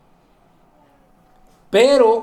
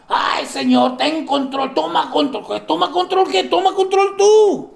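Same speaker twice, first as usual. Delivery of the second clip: mock pleading then retort; 6 semitones higher than usual. Mocking believers' plea, God's reply.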